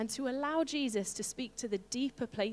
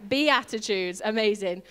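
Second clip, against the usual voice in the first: loud, punchy BEATITUDES with some attitude; 7 dB louder than usual. Shown as a demonstration. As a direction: give BEATITUDES some sass and volume. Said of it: "give it some attitude".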